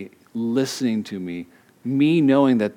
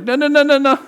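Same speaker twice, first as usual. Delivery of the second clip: high, loud, laughing no's; a laughing, loud mock refusal; laughing protest; 14 semitones higher, 8 dB louder, faster than usual. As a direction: wives cutting off the fix-it husband, laughing. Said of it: "And they're like".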